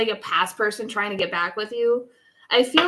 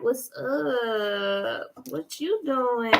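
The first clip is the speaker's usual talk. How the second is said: hammed-up flirting, giggle creeping in; mock flirty; much slower than usual. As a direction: imitate a smooth flirt, lingering on each greeting. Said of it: "really talk like that".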